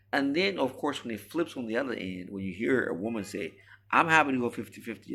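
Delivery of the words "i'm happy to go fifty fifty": higher, louder and fast, mocking; mock woman's claim. Mocking women who offer to split costs, quick high mock. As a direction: rattle it off like a too-good-to-be-true promise.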